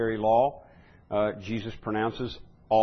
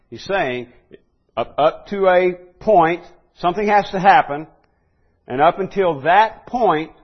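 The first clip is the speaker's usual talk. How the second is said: louder and higher, insistent; fervent pulpit delivery; preaching at full force; 6 semitones higher, 9 dB louder than usual. Acted. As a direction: proclaim it to the back row.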